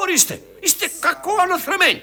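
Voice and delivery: very high-pitched reprimand; outraged scolding. Acted. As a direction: scold the rude onlookers, voice leaping up. Director scolds those laughing.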